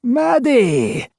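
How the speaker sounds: high, loud cry of delight; joyful exclamation. A narrator's reading. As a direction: burst out with sudden joy. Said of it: "the voice joyfully exclaimed".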